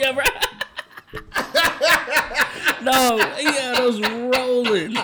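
loud, high words through laughter; cracking up. Real, not acted. Host recalling how old school had them rolling, laughing hard. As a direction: say it while helpless with real laughter.

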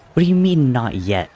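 sharp high demand, loud on the first words. A narrator's reading. Tommy demanding an answer from Wilbur, loud and high. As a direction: push back hard, voice jumping up high and loud. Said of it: "Tommy demanded".

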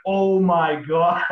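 loud, drawn-out mock dismay; hammed-up East Coast horror; horrified Nova Scotia accent. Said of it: "they just, like, go like that".

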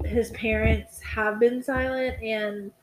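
ordinary delivery

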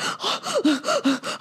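high repeated waking gasps; acted panicked gasps. Mother acting out waking from a nightmare, panicked gasping. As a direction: gasp for air as if jolted from a nightmare.

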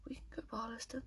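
whispered in disbelief; hushed, stunned whisper. Real, not acted. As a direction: breathe the name out, no voice. Whispered reply.

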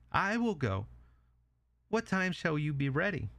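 high, small pig voice; agreeable little pig. Acted.

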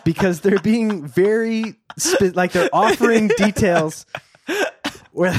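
normal talking voice

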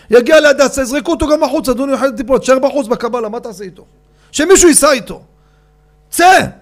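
high, loud, dismissive tirade; angry shout. Retold or imitated. Father refusing to leave his lesson, angry shouting. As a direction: order them out, furious.

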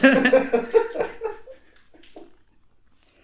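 shrieking with laughter; cracking up hard; loud high laughter. Real, not acted. Joke about interviewing Hitler.

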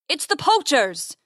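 slow, high sing-out; calling from outside. A narrator's reading. Poacher announcing his gang from outside, calling out. Outlaw outside calls in to them.

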